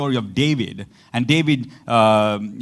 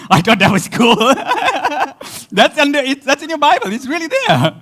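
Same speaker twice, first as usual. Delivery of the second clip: real laughter, voice pitched up high; amused disbelief; 11 semitones higher, 5 dB louder than usual. Real, not acted. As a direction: insist on it through giggles, high-pitched. Cracking up over word in Bible.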